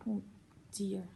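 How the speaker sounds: usual speaking voice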